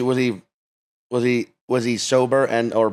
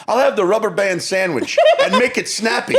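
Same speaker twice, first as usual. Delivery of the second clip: lightly voiced jokey dad, higher pitch; funny dad bit; 8 semitones higher than usual. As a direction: deliver a corny waiter order like a dad. Friend's dad's stock waiter joke.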